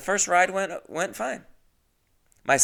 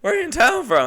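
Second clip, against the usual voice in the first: very high, loud mock small talk; hammed-up high-pitched self-parody; exaggerated own voice; 8 dB louder, faster than usual. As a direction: squeal the question in an overblown chatty voice. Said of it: "That's what my voice sounded like".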